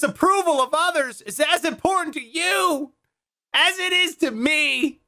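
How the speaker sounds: high-pitched, declaiming daredevil dad; strained, hammed-up confession called from afar; mock heroic father. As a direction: proclaim it high, imitating a self-important father. Acted speech of magician to daughter.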